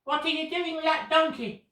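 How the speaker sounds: high, loud challenging yell from the crowd; angry shout. Retold or imitated. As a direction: call out to stop the thieves, high and loud. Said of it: "some people shouted at them and said".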